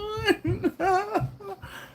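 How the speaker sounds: high, helpless laugh; cracking up. Real, not acted. Streamer cracking up at Sean's joke, high laugh. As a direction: laugh until it squeaks.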